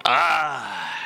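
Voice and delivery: normal talking voice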